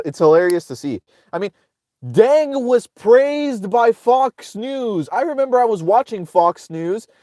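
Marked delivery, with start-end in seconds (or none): 2.1-5.1 drawn out very slowly and pitched higher; a slow, high, mock-solemn announcement; slow emphatic. Real, not acted. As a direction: lay each word out with mock gravity.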